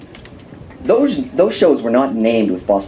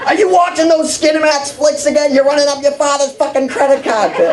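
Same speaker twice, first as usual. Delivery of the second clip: Boston mom; 13 semitones higher than usual.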